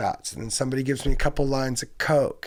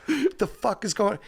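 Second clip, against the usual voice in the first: higher, baffled outburst; confused; 8 semitones higher than usual. Retold or imitated. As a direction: sound lost and startled. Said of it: "and I'm like".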